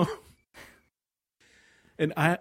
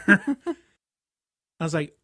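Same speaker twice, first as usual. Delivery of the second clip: normal talking voice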